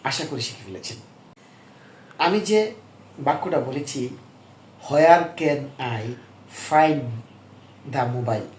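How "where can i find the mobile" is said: very slow, every word apart; the easy learner version; slow, plain classroom English; slow simple English. Shown as a demonstration. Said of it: "এটা কিন্তু এরকম সহজ ভাষায় বলবে না".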